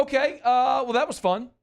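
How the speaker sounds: hammed-up cheery wrap-up; chipper. Mock chipper sign-off.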